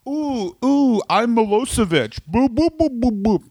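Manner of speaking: high-pitched silly cartoon caricature; Milosevic. Comedian mocking a leader for his co-host, silly high impression. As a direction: hoot it high and daft, like a nonsense impression. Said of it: "That's him".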